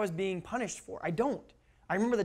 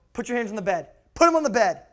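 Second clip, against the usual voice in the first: imitated stern order, building louder; angry dad; 2 semitones higher, 7 dB louder than usual. Dad losing temper orders child to bed, stern mock anger. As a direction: order the kid sternly, punching the repeat. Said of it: "when he would walk in and go".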